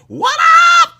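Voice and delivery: high, loud shout of what; loud, high greeting shout. Real, not acted. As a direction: call it out big.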